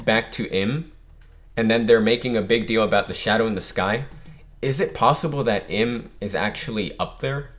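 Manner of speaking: usual speaking voice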